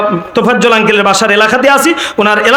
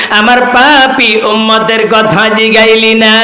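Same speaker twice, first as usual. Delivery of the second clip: melodic storytelling chant with long-held cries; imitated waz preacher, stretched wailing calls; Tofazzol Bhairabi impression. Shown as a demonstration. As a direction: chant the sacred tale as an imploring lament. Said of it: "ওনার মতো হুবহু হয়েছে তো".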